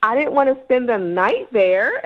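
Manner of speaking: ordinary talk, nothing marked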